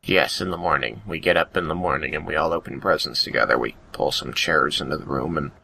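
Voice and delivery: ordinary talk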